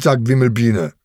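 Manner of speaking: ordinary delivery, nothing marked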